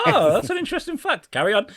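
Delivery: high-pitched mock fascination; mock interested. Listener interrupted mid-lovemaking by a podcast, mock interested. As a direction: perk up high, as if intrigued.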